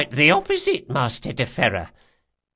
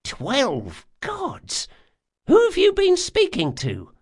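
very high-pitched amazement; astonished old woman. Acted. Guildmistress to the bearer of a rare gift, astonished. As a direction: exclaim in high astonishment, then demand to know.

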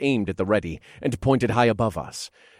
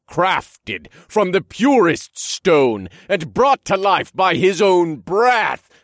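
slow, loud stone giant voice; giant booming voice; 4 semitones higher, 7 dB louder, slower than usual. Acted. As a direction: boom down from the sky. Elder Warrior taunts from clouds.

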